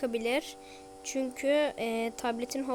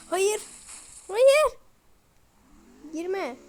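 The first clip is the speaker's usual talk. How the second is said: two cries of no, the second far higher and louder; panicked shout; 7 semitones higher, 9 dB louder, much slower than usual. Real, not acted. Gamer sees danger, panicked rising no. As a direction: yell it in rising alarm.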